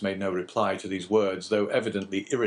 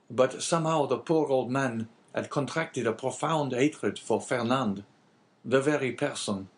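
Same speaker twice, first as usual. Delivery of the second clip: higher-pitched innkeeper character voice; lightly voiced Caderousse; 6 semitones higher than usual. Innkeeper answering the abbé, lightly voiced character. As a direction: lightly play the talkative innkeeper.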